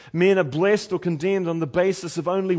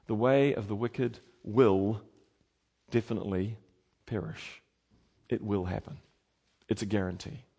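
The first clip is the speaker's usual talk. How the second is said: hushed warning; 6 semitones lower, 6 dB quieter than usual. Acted. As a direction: drop to a grave near-whisper. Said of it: "solemn quiet warning".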